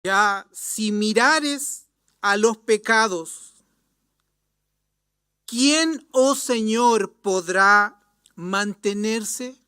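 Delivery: loud, slow psalm recitation; fervent scripture plea. Acted. Psalmist sorrowing over sin cries in prayer, fervent plea. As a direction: call up to God from the depths. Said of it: "expresó la gran tristeza por su pecado".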